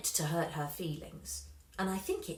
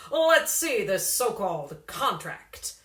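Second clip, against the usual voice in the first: slow loud scornful manager; 8 dB louder, slower than usual. A narrator's reading. Slingsby voiced by narrator.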